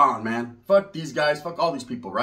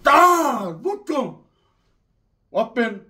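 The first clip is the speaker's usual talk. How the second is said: ordinary delivery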